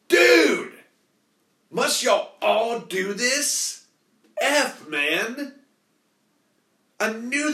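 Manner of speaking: fed-up cry at the flaky guy; exasperated outburst. Real, not acted. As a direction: throw up your hands in disbelief.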